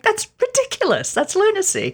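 very high, scoffing squeak; squeaky, exaggerated outrage; mock incredulous. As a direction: protest in comic disbelief, pitch way up. Crowley's retort acted out.

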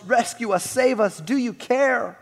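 high, loud desperate cries; storybook sailors yelling for rescue; yelled plea. A narrator's reading. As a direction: call out in urgent bursts. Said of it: "They yelled".

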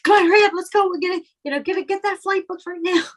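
high, pushy and rushed, mock hurry; a mock East Coast caller rushing the airline agent, high and impatient. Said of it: "other people would be like".

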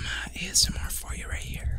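soft whisper close on the mic; ASMR demo. Shown as a demonstration. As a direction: whisper it as a mock ASMR offer. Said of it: "Let me get some".

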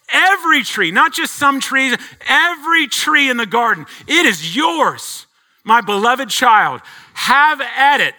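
high, loud, "every" punched; joyful generous offer. Acted. Preacher voicing God giving his child every tree, jubilant. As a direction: exult in giving everything away.

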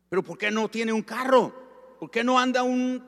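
loud, very high, astonished questioning; incredulous challenge. Retold or imitated. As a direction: imitate someone scandalised by what he hears. Astonished brother challenges the pastor.

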